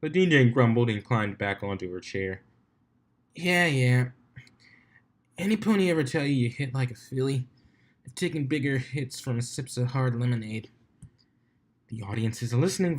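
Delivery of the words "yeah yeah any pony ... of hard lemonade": grumpy DJ. Acted. DJ grumbling at Octavia, lightly voiced. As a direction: grumble back as the sore DJ pony.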